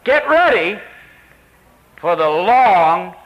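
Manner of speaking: rally the hearers for the long haul; high, loud, drawn-out call to endure; Brother Sam's charge. Retold or imitated. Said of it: "And Brother Sam said".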